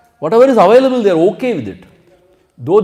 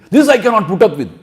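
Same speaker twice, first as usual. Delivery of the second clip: loud complaint; 5 semitones higher, 6 dB louder than usual. Retold or imitated. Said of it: "third day, they say".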